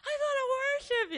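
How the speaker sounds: high, yearning inner voice; longing cry. Retold or imitated. Pastor's wife quoting her own longing, high and yearning. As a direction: voice the heart's longing. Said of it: "'cause I'm like".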